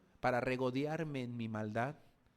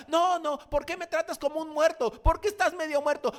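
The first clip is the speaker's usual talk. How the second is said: much higher, loud indignant protest; offended half-dead man; 14 semitones higher, 10 dB louder than usual. Acted. Half-dead man refusing the Samaritan's help, high indignant protest. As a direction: bristle at being called dead.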